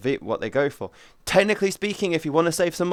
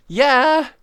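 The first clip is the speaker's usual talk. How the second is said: high, loud eager cry; high-pitched gushing yes; excited squeal; 10 semitones higher, 8 dB louder, slower than usual. Retold or imitated. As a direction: squeal it like a thrilled fan. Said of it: "I'm like".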